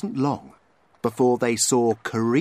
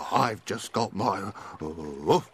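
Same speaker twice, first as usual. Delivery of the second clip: low, slow dog voice; lightly voiced dog; 4 semitones lower, slower than usual. A narrator's reading. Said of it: "said Dick the dog".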